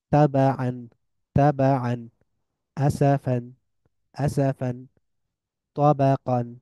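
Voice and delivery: ordinary delivery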